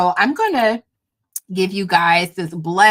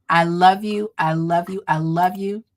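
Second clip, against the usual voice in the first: normal talking voice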